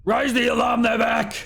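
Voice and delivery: high, loud alarm shout. Acted. Giant shouts to the other giant, urgent shout. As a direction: yell it down the hallway. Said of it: "one of them shouts to the other".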